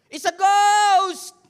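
very loud, high, drawn-out scream of fear; terrified cry. Acted. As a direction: shriek it like a frightened disciple.

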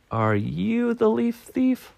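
high, slow suspicious squirrel; accusing Squirrel. Acted. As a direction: draw out a high-pitched accusation.

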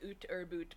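"oot" and "boot" with a long oo; mock-Canadian oo in "oot or boot"; Canadian vowel. Shown as a demonstration. Friend teasing cohost about a Canadian-sounding accent, mock-Canadian vowels. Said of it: "you don't say, like".

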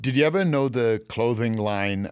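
ordinary talk

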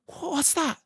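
put-on high startled young girl; a frightened child asking; scared girl voice. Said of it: "said Lucy, clutching Susan's arm".

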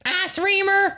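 put-on shonen boy voice. Host cracking a joke in a high shonen voice. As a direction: blurt it in the squeaky hero voice.

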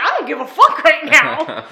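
laughing, half-joking retort to her friend; laughing mock snap. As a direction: bark it while laughing. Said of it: "I'm like".

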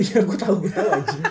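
chuckling through the words; laughing. Real, not acted. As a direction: laugh it off as you speak. Laughing at obvious answer.

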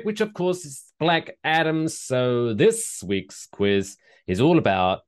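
normal talking voice

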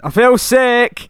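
high, loud and slow, blurting it out; sudden shout. Retold or imitated. Boyfriend suddenly blurts to the chatting doctor, loud shout. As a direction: let it burst out of nowhere.

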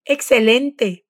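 ordinary talk

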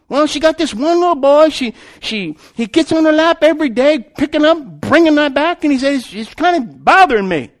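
loud, high-pitched flustered griping; bothered bus driver. Retold or imitated. Bus driver explains his grievance to boss, loud and agitated. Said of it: "bus driver got a little bit bothered".

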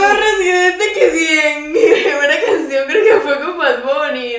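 loud, high confession on the edge of laughter; laughing wail. Real, not acted. Confessing a silly mix-up to the co-host, laughing wail. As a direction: wail it out, cracking up.

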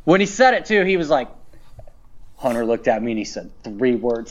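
normal talking voice

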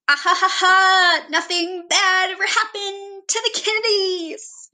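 high, drawn-out cartoon catchphrase with a big laugh; Clone High character quote. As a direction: launch into a slow, high-pitched TV-show impression. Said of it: "there's another one from ... Clone High".

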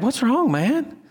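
high-pitched, gentle questioning; concerned coach. Retold or imitated. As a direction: check on an upset kid. Coach voicing himself to crying boy.

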